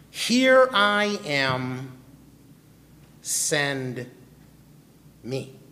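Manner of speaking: very slow, solemn offering of himself; a prophet stepping forward, slow and hushed at the end; Isaiah answering. Acted. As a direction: let each word land, slow and devoted. Isaiah's answer quoted in sermon.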